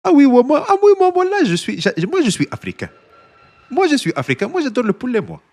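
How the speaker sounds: very high, squeaky African-accented French impression; Mister Pokey impression, African French accent. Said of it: "I sound African in my, uh, Mister Pokey, uh, impression".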